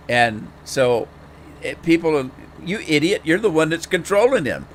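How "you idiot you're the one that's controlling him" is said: higher-pitched imitation of exasperated viewers; mock scolding. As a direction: mimic people telling you off.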